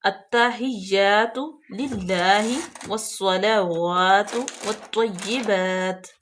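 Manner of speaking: very slow, drawn-out Arabic recitation; تلاوت آہستہ آہستہ. Shown as a demonstration. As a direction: recite each word slowly for the student. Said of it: "تو چلیے start کرتے ہیں".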